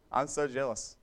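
ordinary delivery

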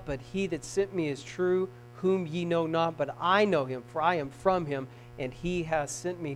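low, soft, measured scripture; hushed recital. Acted. Jesus speaking once everybody gets quiet, hushed recital. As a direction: say it low into a silenced crowd.

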